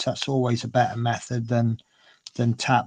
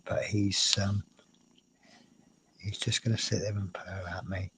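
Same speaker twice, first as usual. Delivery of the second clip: usual speaking voice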